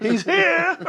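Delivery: high, loud call of he's here; excited shout. Retold or imitated. Neighbors shouting that Rob has arrived, loud excited call. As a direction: shout it like the whole block knows. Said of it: "They say".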